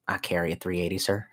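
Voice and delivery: low, very soft imitation; gentle-volume mimicry of the boss; soft-spoken boss. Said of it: "he was very soft-spoken".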